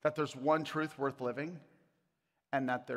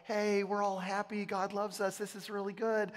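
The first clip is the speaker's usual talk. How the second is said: higher, lightly sing-song cheerful voice; mock joyful; 5 semitones higher than usual. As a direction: imitate a happy, everything-is-great letter. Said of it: "like, this joyful".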